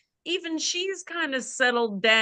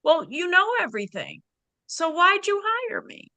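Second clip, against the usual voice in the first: bemused high question; 8 semitones higher, faster than usual. Retold or imitated. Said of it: "with a smile".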